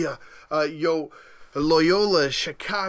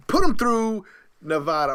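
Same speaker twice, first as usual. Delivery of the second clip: loud, higher shout of the pick; hype call; 4 semitones higher, 6 dB louder than usual. Real, not acted. Host sending Nevada through, loud hype call. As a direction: shout it like a done deal.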